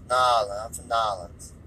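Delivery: imitated odd accent, trailing down; put-on accent with swallowed syllables; Tommy Wiseau. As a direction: repeat it in a slurred mock foreign accent. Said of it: "the Tommy Wiseau accent".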